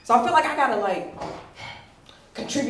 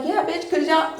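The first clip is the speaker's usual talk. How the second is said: higher, irritated retort in own dialect; dropped polite voice; 8 semitones higher, 4 dB louder than usual. Retold or imitated.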